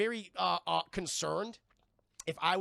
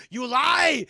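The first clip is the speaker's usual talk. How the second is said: much louder, higher enraged roar; furious shout; 6 semitones higher, 14 dB louder than usual. Retold or imitated. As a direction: bellow at a liar. Freeze enraged at lie.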